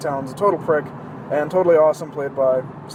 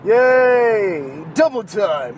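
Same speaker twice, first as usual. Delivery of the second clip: mock cheer; 3 semitones higher, 4 dB louder, much slower than usual. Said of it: "It's like".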